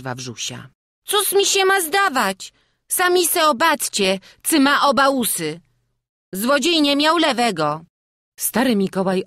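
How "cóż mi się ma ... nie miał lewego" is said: put-on very high, loud, insistent child; small boy. A narrator's reading. Wawrzuś defends his testimony to the burmistrz, child's voice. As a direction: insist in a little lad's high voice.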